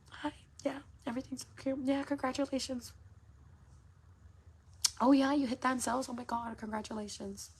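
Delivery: high, bright mock sweetness of a fake friend; fake friendly voice. Mocking fake friends who pretend to be kind, sugary high voice. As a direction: ham up the phony congratulations.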